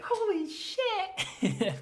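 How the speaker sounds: very high, drawn-out, laughing amazement; mock amazed. Bella caught mid-air, squealing in laughing awe. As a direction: stretch it out in giggly disbelief.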